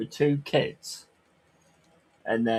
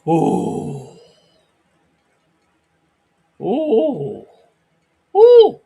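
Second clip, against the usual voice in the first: teasing oohs climbing higher and louder; rising teasing ooh; 8 semitones higher, 10 dB louder, much slower than usual. Acted. Mocking a friend's jab, rising teasing oohs. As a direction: ooh each time higher until a squeal.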